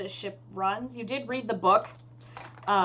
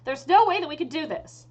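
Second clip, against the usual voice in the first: pitched-up, final refusal; exasperated elf mage; 7 semitones higher, 6 dB louder than usual. Acted. Elf mage refuses the envoy's plan, exasperated. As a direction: dig in, high and insistent.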